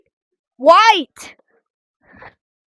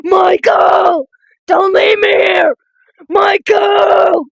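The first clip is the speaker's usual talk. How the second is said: drawn-out mock-desperate shout; calling out; much slower than usual. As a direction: cry out after a friend leaving you.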